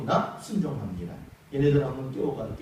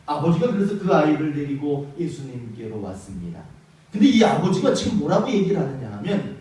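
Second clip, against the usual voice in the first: usual speaking voice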